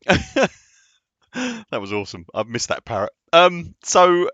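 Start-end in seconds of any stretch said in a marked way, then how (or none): 0.1-2.3 laughing. Real, not acted. Laughing at the parrot donation.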